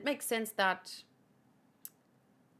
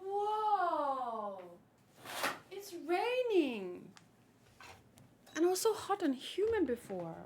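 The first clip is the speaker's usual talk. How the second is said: surprised; 7 semitones higher, 3 dB quieter, slower than usual. Real, not acted. Surprised it is raining.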